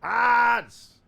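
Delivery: high, very loud drawn-out shout. Real, not acted. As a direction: yell it out as a hype call. Shouted greeting to the stream.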